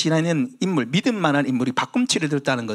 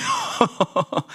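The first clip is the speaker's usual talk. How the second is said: short laugh; 8 semitones higher than usual. Real, not acted. Laughs at own joke.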